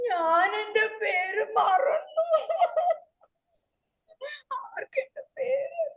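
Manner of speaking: slow, loud, high sobbing cry; crying wail. Acted. Wife sobbing to her husband that she forgot her name, slow loud wail.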